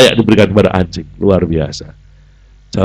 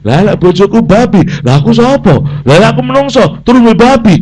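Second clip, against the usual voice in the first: comic dawning realisation; 9 semitones higher, 4 dB louder than usual. Acted. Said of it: "dia mikir".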